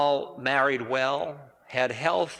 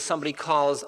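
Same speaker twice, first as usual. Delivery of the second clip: ordinary talk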